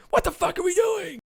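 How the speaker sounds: very high, squealed mock complaint; exasperated mock outcry. Voicing James Harden after the three, exasperated high cry.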